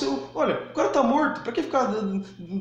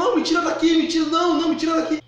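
higher, louder panicked mock scream; desperate scream; 8 semitones higher, 5 dB louder, faster than usual. Wife fallen into the grave, begging to get out: desperate, higher, louder mock scream. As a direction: beg to be pulled out in a panic. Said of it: "ela ficou no desespero".

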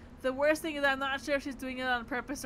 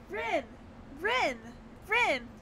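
exclaiming; 4 semitones higher, much slower than usual. Acted. Calling out in alarm.

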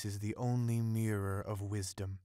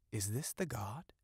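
softer, hushed question; 5 dB quieter than usual. A narrator's reading. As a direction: ask it in a hushed voice. Asking before the ebony idol.

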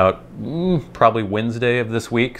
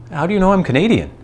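high, quick indignant question; offended; 10 semitones higher, 3 dB louder, faster than usual. Retold or imitated. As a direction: mimic someone taking offence, pitched high. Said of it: "He was actually offended".

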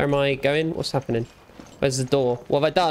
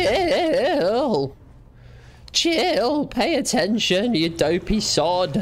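high calling shout, then slower scolding; calling out Jill; 6 semitones higher, much slower than usual. Retold or imitated. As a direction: yell for Jill, then tell her off slowly. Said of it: "I go".

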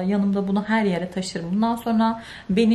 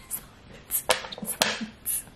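whispered, breathy verdict of disgust; 11 dB quieter, slower than usual. Real, not acted. Vlogger judging the samples, in a disgusted whisper.

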